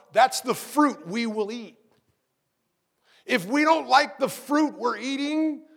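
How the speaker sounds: rising preacher's cry; full-force preaching. Acted. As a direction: build the congregation up.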